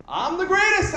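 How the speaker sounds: shouted high, mock triumphant boast. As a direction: crow it like a winner. Mock bragging subject line.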